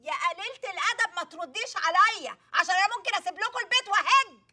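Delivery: angry scolding. Acted. Frazzled mother scolding her cheeky daughter, angry. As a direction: lash out with a threat to walk out.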